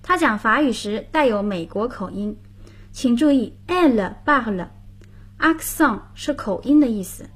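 ordinary talk, nothing marked

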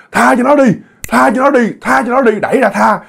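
high, begging plea; pleading ghost. Retold or imitated. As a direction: beg them to let go, pitched high. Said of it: "ông cứ nói hả".

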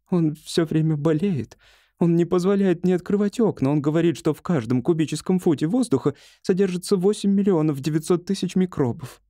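high, earnest female character voice; worried wife. Acted. Wife tells doctor of husband's ailments, worried. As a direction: list the complaints fretfully, pitched high.

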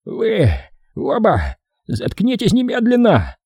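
high, breathless wheeze; wheezing, out of breath. Acted. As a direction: gasp it out hoarsely. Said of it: "задыхаясь, прохрипел Виктор".

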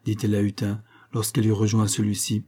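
ordinary delivery, nothing marked

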